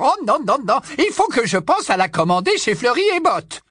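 put-on high, loud old busybody voice; high loud tipsy old aunt gushing; old aunt Muriel. Muriel gossiping.

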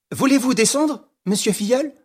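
high, lifted question; inviting call. Acted. Calling the magistrate down into vault.

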